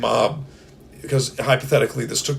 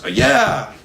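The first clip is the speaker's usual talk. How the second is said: excited cheer; 10 semitones higher, 5 dB louder than usual. Pretend. Crowd cheering the entrance.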